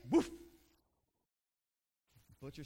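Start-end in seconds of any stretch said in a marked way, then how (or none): 0.1-0.3 short imitated woof; single dog bark. Dog answering the butcher in joke.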